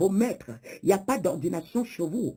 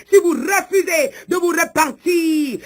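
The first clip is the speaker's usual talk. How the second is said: high, loud, slow threat; wrathful preacher's cry; thundering warning; 8 semitones higher, 5 dB louder, slower than usual. Acted. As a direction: shout the warning with drawn-out words. Shouted threat of divine wrath.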